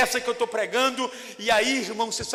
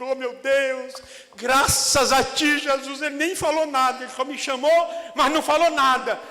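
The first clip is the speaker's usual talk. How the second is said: loud, high, anxious relief; anxious Peter; 6 semitones higher, 6 dB louder than usual. Acted. Peter worrying aloud to God, loud and anxious. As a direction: cry out nervously to God. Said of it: "E Pedro todo escabriado".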